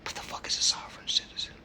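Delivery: whispered disbelief. Real, not acted. Asking himself about sovereign citizens, whispered. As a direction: whisper it to yourself, baffled. Incredulous aside.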